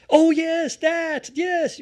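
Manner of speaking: high, thrilled gasp of recognition; delighted character reaction. Acted. Host acting out Ripley spotting the machine, high delighted. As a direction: squeal it like you've spotted the perfect weapon.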